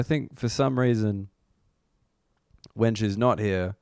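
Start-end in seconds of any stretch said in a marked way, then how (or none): none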